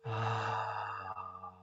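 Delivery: long soft breathy "ah" out; relaxed exhaled sigh. Shown as a demonstration. Guide modelling the out breath, long relaxed sigh. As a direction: let the breath fall out in a sigh.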